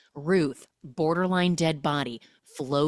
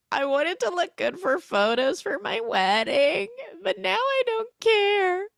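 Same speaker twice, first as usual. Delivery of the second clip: much higher, mock sobbing whine; teary high bride; 11 semitones higher than usual. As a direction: play the bride about to cry. [name] voiced, bride on the verge of tears.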